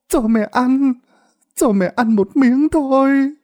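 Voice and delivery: put-on high, slow old woman begging for food; frail begging; whimpering plea. A narrator's reading. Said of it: "bà cũng mếu máo mà nói".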